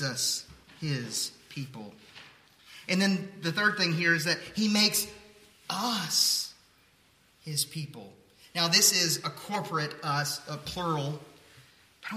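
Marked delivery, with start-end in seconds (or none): none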